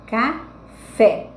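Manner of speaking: café broken in two, loud FÉ after a pause; teacher's slow split ca... FÉ; syllables split, last stressed. Shown as a demonstration. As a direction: say ca, wait, then a strong FÉ in café. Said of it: "a última tá mais forte".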